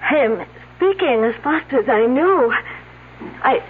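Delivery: put-on light French accent throughout. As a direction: speak English with a French lilt. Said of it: "unless someone's imitating her accent".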